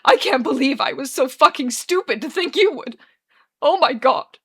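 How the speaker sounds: loud, high, anguished outburst; sobbing despair. Acted. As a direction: berate yourself through sobs. He sobs.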